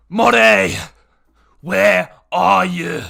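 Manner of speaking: huge, high, drawn-out bellow; very loud, slow shout from a big voice; bellowing yell. Acted. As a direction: holler for someone missing, stretching the words. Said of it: "and it yells".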